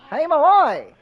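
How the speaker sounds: high, loud cartoon host voice; show host voice. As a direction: call out to Malloy. Garbo host voice.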